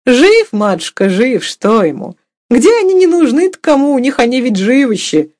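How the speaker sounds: high, loud, animated peasant woman; reply high and loud, brushing it aside; offhand cook. A narrator's reading. Cook answers mistress about her boy, loudly dismissive.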